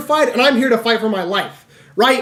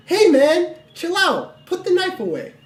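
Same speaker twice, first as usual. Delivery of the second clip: retold placating; 7 semitones higher, slower than usual. Friend tells knifeman to put it away, high-pitched retold placating. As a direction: talk him down in a high, easing voice.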